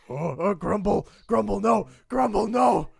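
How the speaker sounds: rising frantic troll plea; panicked troll. Acted. Troll pleads with Grumble.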